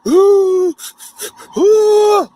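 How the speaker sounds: high loud crying ooh; sobbing wail. Retold or imitated. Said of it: "I broke down crying".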